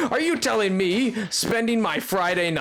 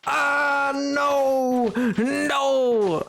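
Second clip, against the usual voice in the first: high wailing no; anguished wail; 8 semitones higher, much slower than usual. Real, not acted. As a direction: cry out in dismay. Dismayed wail at game event.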